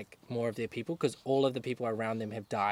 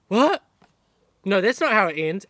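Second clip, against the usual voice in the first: retold shock; 7 semitones higher, 9 dB louder than usual. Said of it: "Feeling like".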